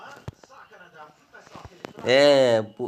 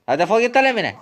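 much higher, loud get-out shout; öfkeli kovma; 8 semitones higher, 4 dB louder, faster than usual. Acted. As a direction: throw him out furiously. Kavgacıyı kovma. (öfkeyle defol diye bağırma)

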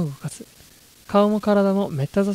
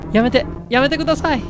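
panicked pleading; 6 semitones higher, 4 dB louder than usual. Acted. Girl fights off attacker.

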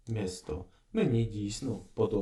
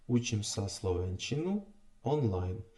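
usual speaking voice

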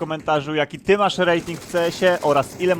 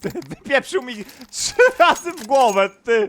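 angry outburst; 6 semitones higher, 6 dB louder than usual. Real, not acted. Shot in the head.

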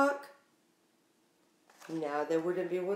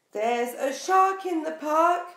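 loud, higher shouted warning; yell with all his might; 7 semitones higher, 6 dB louder than usual. Acted. Timothy yells a warning to the park, loud and high. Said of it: "Timothy yells with all his might".

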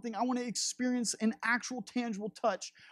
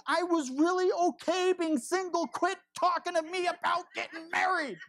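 a mock-angry rant, half laughing by the end; frustrated rant at God; 6 semitones higher than usual. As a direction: vent at God, getting more fed up. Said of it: "He's like".

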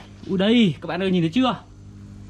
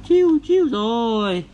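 mouth sound effect; 4 semitones higher, 5 dB louder, much slower than usual. Acted. Toy fishing, reel sound.

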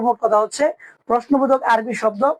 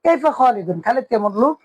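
ordinary delivery

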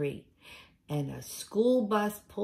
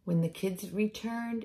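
ordinary delivery, nothing marked

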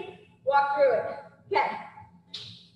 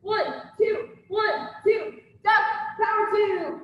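boxing coach calling combos; 7 semitones higher, slower than usual. Acted. Instructor calling combo reps.

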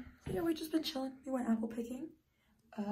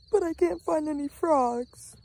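mock dramatic; 7 semitones higher, 7 dB louder than usual. Mock dramatic bad news.

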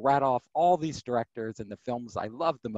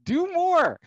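put-on pleading young self; 5 semitones higher than usual. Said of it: "I was like".

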